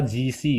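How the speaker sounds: G C with voiced j and ee vowels; wrong reading ji xi. Shown as a demonstration. Teacher showing the misreading, ee vowels. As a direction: clear ji-si for G C. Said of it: "bukan dibaca G C".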